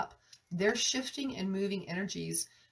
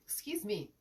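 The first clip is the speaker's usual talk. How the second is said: voice with a cold; 6 semitones higher than usual. Real, not acted. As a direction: sniffly voice from a cold. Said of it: "I'm just coming over a cold here".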